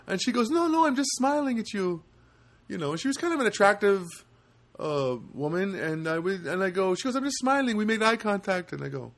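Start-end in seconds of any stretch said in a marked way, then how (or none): 0.5-2.0 higher-pitched imitation of a woman; lightly voiced woman. Woman explaining herself to a stranger, lightly voiced high. Woman reassures him in retold encounter. 7.2-8.7 higher-pitched imitation of a woman; lightly voiced woman. Woman explaining herself to a stranger, lightly voiced high.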